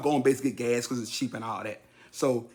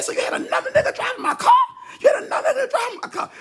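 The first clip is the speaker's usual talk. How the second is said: high, loud, fast outraged shout; going off; 4 dB louder, faster than usual. Retold or imitated. Son blows up at dad over the car, outraged shout. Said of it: "But I was going off".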